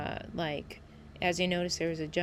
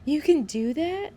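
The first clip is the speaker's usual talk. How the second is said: replaying an excited "oh my God" moment; 5 semitones higher than usual. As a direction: act out thrilled disbelief. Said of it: "I was, like, so excited".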